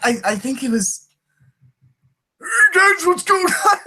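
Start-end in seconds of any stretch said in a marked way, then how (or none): 2.4-3.8 very high put-on character voice, a bit louder; creaky old-man take on a jock; old-sounding jock voice. Shown as a demonstration. Trying out the original voice for a jock character, old-sounding jock voice. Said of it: "what the original voice was".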